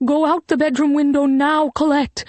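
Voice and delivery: high, urgent mother's order; panicked shout. A narrator's reading. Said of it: "her voice high with panic".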